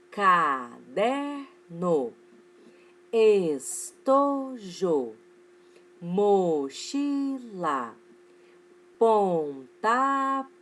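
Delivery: "caderno", "estojo", "mochila" drawn out in three long, separate syllables. Shown as a demonstration. Teacher giving three-syllable examples to pupils, syllable by syllable. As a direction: stretch every syllable of "pontapé" and "maduro" very slowly. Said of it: "fazer a separação silábica primeiro".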